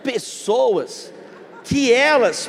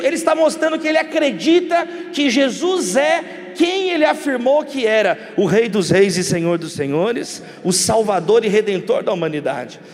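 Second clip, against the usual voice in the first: ordinary talk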